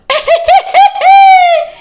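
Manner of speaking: high, loud scornful cackle; mocking laugh. A narrator's reading. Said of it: "Asabi laughed".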